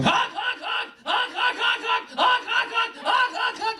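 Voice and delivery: high shouted goose honks; goose honking. Retold or imitated. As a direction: honk like a panicked goose. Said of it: "a perfect impression".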